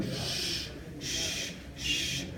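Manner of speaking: soft, spaced-out shushes; shushing. Pretend. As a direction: hush a whole stadium. Acts shushing a stadium.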